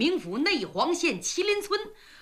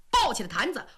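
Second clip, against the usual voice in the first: ordinary delivery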